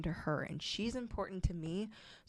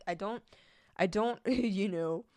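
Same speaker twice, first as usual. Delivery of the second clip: usual speaking voice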